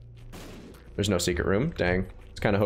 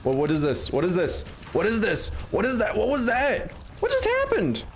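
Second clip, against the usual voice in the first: frantic, rising higher and higher; raging gamer; 8 semitones higher than usual. Real, not acted. Panicking in weird boss fight.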